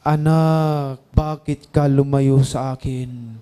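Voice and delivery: low, slow, booming voice of God; a deep, slow, loud and grave call from heaven. Retold or imitated. Voice of God in dream. (tinig ng Panginoon, mababa, mabagal at malakas)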